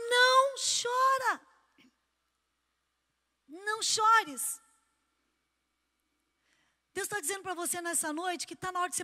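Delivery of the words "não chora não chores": slow, tender words of consolation; gentle comfort. Acted. Jesus comforting the weeping widow, slow and tender. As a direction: soothe her slowly, like Jesus to the widow.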